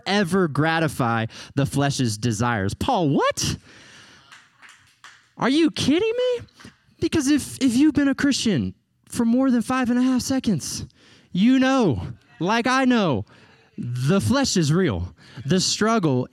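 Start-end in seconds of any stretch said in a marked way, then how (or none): 2.7-13.2 high, incredulous cry of disbelief; mock disbelief. Preacher doubting Paul's promise, incredulous. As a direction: act stunned, like it can't be true.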